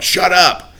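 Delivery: short, high-pitched mock yell; mock yell at the dog. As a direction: yell it in imitation of an annoyed owner. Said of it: "people would yell at the dog".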